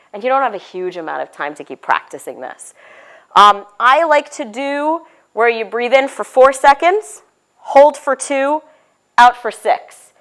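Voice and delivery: normal talking voice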